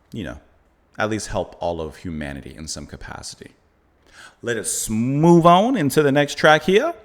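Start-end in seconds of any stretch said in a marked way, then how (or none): 4.4-6.9 slow, louder mock Kentucky accent; drawn-out Southern Kentucky voice, hammed up. Reviewer announcing the next track, in a mock Kentucky accent. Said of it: "like a Kentucky accent".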